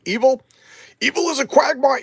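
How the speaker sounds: high, loud declamation; hammed-up heroic proclamation; theatrical defiance. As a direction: declare it grandly, pitch way up. Acting Doctor Fate's defiant answer.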